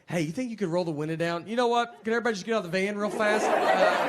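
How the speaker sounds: a man losing it politely in a packed van; mock panic, speeding up to a frantic plea; claustrophobic panic. Panicking in the back of the Uber.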